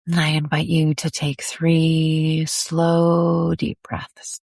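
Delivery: slow, lingering meditation voice with drawn-out words; guided meditation. Acted. Host guiding listeners to settle into the body, slow meditation. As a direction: ease them slowly inward, pausing between phrases.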